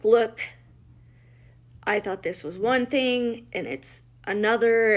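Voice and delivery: urgent to police. Retold or imitated. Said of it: "I'm like".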